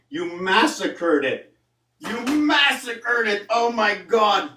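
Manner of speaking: high, slow, aghast lament; shocked dismay. Real, not acted. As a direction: drag it out in disbelief.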